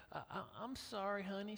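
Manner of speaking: husband caught out, timidly apologising to wife; sheepish apology. Retold or imitated. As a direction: apologise small and guilty. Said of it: "And I'm like".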